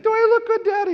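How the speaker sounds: chirpy put-on four-year-old asking hopefully; imitating little son. Father mimics his son asking about the haircut, high child voice. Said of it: "He's like".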